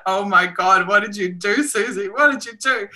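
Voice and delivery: mock horror at herself, pitch up; self-mocking dismay. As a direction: scold yourself in mock disbelief. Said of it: "it's like".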